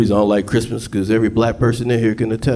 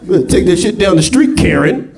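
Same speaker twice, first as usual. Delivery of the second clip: angry homeowner; 8 semitones higher than usual. Acted. Yelling at carolers on porch.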